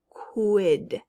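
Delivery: QUID as a stretched "kwid"; isolated syllable. Shown as a demonstration. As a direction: sound out QUID alone. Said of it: "second syllable".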